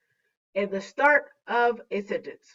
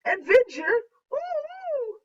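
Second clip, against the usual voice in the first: kinda scared; 14 semitones higher than usual. Acted. Teacher rereads the example as if frightened, kinda scared. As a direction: play scaredy-cat, pitched way up. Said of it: "maybe the woohoo is kinda scared".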